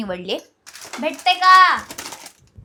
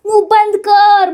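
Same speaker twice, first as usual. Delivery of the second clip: loud scolding; 6 semitones higher, 10 dB louder, slower than usual. Real, not acted. Telling him to shut up.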